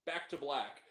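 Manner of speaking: ordinary delivery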